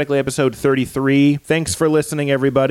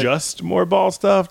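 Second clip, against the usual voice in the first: high, slow mock disbelief; slow teasing echo of a friend's words; 7 semitones higher, much slower than usual. Real, not acted. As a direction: drag it out, ribbing a friend. Teasing repeat of partner.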